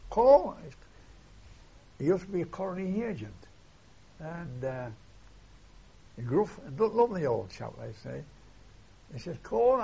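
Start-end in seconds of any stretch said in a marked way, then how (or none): 0.1-0.5 high, loud call-out; sudden sharp holler, well above talking voice; retold shout. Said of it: "he shouted at me". 9.4-9.8 loud, high-pitched summons; raised-voice hail; retold shout. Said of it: "he shouted at me".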